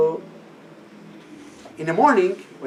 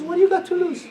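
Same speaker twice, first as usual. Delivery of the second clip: teacher's voice; 13 semitones higher, faster than usual. Retold or imitated.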